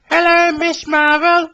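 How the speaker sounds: high, friendly tank engine greeting; high, cheerful hello; smiling Billy. Acted. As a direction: greet her brightly, pitched high. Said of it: "smiled Billy".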